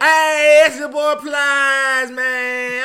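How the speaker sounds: hammed-up hollering rapper voice. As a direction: shout it like a hyped-up Florida rapper. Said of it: "What does [name] sound like?".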